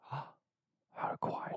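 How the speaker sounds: real hushed whisper, slowed right down. Real, not acted. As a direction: whisper it as if not to be overheard. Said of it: "Why are you whispering?".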